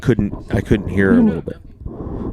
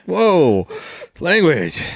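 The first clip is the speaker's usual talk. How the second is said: higher, teasing mock-scandalized; mock shocked; 8 semitones higher, much slower than usual. Son teasing his swearing dad, mock shocked and higher. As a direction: play the prim scold, tongue in cheek. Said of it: "I'm like".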